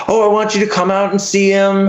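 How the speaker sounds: lightly mock her sudden niceness; a hint of a falsely sweet ex. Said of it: "going back and forth".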